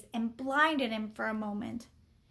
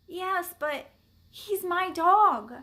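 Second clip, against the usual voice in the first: high-pitched earnest protest; pleading explanation; 5 semitones higher, 4 dB louder, slower than usual. A narrator's reading. Boy explains himself to the man, pleading. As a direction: plead to be understood. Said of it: "Henry tried to explain".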